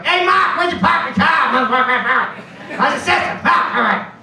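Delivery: high, loud, choking-duck Boston squawk; blue-collar Boston accent. Shown as a demonstration. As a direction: squawk it in a rough working-class Boston accent. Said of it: "blue collar Boston accent that sounds like a duck choking".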